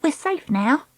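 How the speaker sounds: high, pert reminder; impatient. Acted. Treacle urging Dakota off the shell, impatient. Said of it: "Treacle said impatiently".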